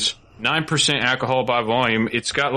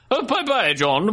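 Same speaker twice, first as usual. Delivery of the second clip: put-on British accent; 8 semitones higher, slower than usual. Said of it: "in my British accent".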